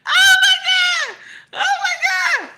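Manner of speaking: high, loud squeal through laughter; real shrieking laughter. Real, not acted. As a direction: crack up and squeal it out. Cracks up at own story.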